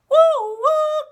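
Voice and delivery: high loud whistling woo woo; train whistle imitation. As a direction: toot like a far-off train. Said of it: "Can you make that noise?".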